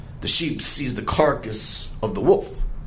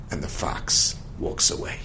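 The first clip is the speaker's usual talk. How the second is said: ordinary delivery, nothing marked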